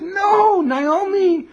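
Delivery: high, loud crying plea; tearful plea. Acted. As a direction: beg her through tears. Daughters beg Naomi to stay.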